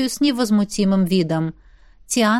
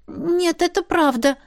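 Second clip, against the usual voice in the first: higher, timid insistence; embarrassed; 8 semitones higher, slower than usual. A narrator's reading. Journalist confesses to the furious host, embarrassed. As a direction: plead sheepishly in a raised voice. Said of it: "смущённо произнесла".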